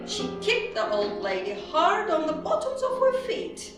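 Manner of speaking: plain reading voice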